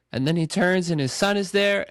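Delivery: ordinary talk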